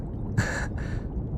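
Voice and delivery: short, quiet breathy laugh; small shy chuckle. Acted. Researcher glad of the bodyguard's answer, small soft chuckle. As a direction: let out a tiny soft laugh.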